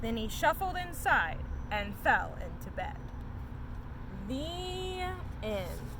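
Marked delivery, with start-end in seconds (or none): none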